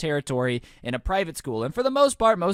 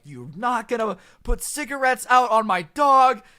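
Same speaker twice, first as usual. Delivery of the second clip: loud, high imitated yell; retold angry yell; 6 semitones higher, 6 dB louder than usual. Friend beats stepdad over burnt dog, imitated angry yelling. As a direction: hammer it out like a furious dog owner.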